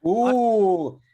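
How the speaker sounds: high, loud ooh before an accent; a delighted high ooh, about to launch into a voice; excited ooh. Real, not acted. Reacting to the Lagos letter with a loud high ooh. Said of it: "I was about to do an accent then".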